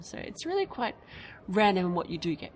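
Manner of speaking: ordinary talk, nothing marked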